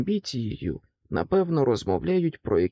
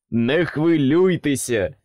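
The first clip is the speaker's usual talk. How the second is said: loud, slow cheerful call; optimism calls; 6 dB louder, slower than usual. A narrator's reading. Optimism calls across the table, loud and cheerful. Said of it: "гукнув він через стіл".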